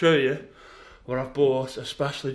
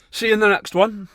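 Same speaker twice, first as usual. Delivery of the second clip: cheery sign-off; 5 semitones higher, 8 dB louder than usual. Real, not acted.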